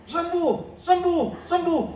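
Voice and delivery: high, ringing mock prayer; mock healing command. Preacher mimicking a student praying for healing, high and fervent. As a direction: belt it like an overeager faith healer.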